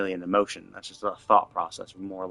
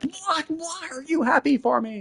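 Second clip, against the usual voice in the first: very high-pitched mocking imitation; exaggerated high voice, ridiculing the complaint; mock outraged whine. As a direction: mimic an outburst in squeaky falsetto. Mocks character's outburst in falsetto.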